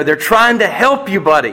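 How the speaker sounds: retold plea to a child, high-pitched; pleading father. Dad urging his son to bear it, pleading.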